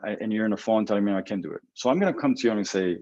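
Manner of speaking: usual speaking voice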